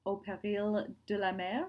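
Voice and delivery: careful, slow French pronunciation; French accent. Real, not acted. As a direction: read the French title slowly in a French accent. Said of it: "My French accent is fantastic".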